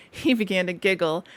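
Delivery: plain reading voice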